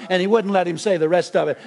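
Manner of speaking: ordinary delivery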